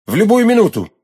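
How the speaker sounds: loud, high exclamation; eager outburst. A narrator's reading. D'Artagnan ready to fight for milady, eager outburst. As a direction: jump at the chance, loud and high. Suitor eagerly accepts a duel.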